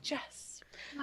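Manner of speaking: whispered, despairing question to the screen; whispered plea. Retold or imitated. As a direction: whisper it as if begging her to stop. Pleading with the heroine on screen.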